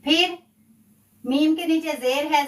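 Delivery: ordinary talk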